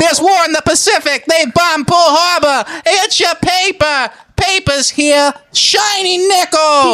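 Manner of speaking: put-on very high old-time street cry, stretched endings; 1945 newsboy. Newsboy selling war news to passers-by, high-pitched holler. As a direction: call out headlines like a forties American newsboy. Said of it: "Nineteen forty-five American selling newspapers".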